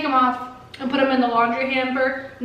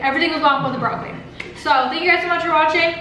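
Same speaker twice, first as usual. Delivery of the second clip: ordinary delivery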